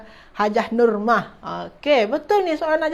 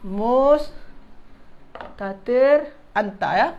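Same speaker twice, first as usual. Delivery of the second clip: Mustatir stretched very slowly; teacher drawing out Mustatir for students; slow term stress; slower than usual. Shown as a demonstration. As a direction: drag out Mustatir for the class. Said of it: "mustatir anta nama pelaku dia".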